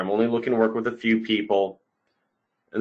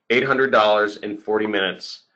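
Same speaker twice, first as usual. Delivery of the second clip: ordinary talk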